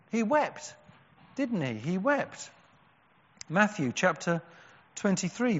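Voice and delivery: normal talking voice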